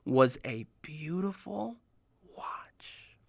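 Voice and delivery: slow, unvoiced hushed reveal; whisper. Kamil revealing the watch inside, whispered. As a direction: whisper the payoff. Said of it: "He said".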